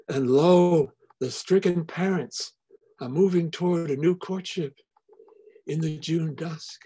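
measured poem recital with long pauses; a poet closing her reading; poetry reading. Acted. Poet reads her poem aloud.

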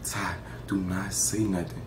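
slow, hushed whisper; imitated whispered warning; urgent whisper. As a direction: whisper it so the phone won't hear. Husband calls, she hushes him.

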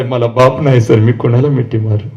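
low, subdued voicing of an orphaned child; sorrowful child, retold. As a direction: quote the child's sad question, pitched well down. Fatherless child's words, retold.

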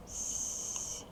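long, soft voiceless hiss on "С"; drawn-out sound. Shown as a demonstration. Teacher asking if it is voiced, long hissed "С". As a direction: sustain "С" as a quiet hiss. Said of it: "Дзвінкий чи глухий".

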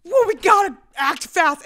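high, loud, drawn-out urging; urgent retold plea. Friend urging cops to search, mock-urgent shout. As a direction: cry out in hammed-up alarm.

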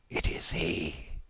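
hushed, slow, dawning dread; paling realisation. A narrator's reading. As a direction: say it under the breath, slowly, shaken. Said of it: "turning pale".

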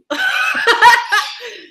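high giggling burst; high laugh. Real, not acted. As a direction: squeal with laughter. Laughing at joke.

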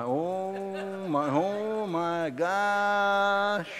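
high, very slow drawn-out gasp of oh my gosh; mock horror. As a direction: act appalled at too many granules. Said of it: "mock horror at tea granules".

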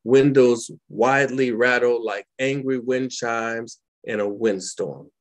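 measured, dramatic poetry recital; spoken-word poem performance; poem reading. Acted. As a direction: read it like a grieving memory. Reads her war poem aloud.

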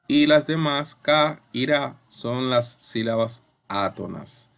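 ordinary talk, nothing marked

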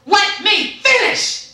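drawn-out, high imitation shout; loud. Customer service rep cutting off caller, loud.

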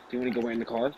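retold question. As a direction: ask it quietly, as quoting someone. Retelling the first, milder asking.

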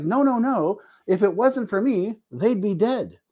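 a parent of an addict, hammed-up and insistent; mock insistent family member. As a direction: imitate the family swearing they're the lifeline. Voicing enabling family members' insistence.

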